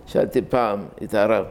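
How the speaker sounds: usual speaking voice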